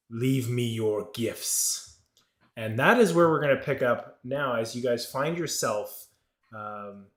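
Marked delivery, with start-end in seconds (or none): 0.1-2.0 slow, gravelly monster voice; deep gravelly voice. Acted. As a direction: rumble it out slowly like a buried beast. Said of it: "deep gravelly voice saying".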